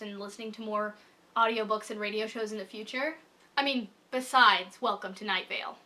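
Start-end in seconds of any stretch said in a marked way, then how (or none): none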